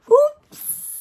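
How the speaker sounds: high, loud playful oops; a cheeky little oops; mock oops. Parent playfully reacting to mess in book.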